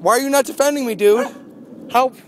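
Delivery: high, loud mock-panicked scolding; mock alarm. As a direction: plead with a useless protector, hammed up. Scolds pet for not defending him.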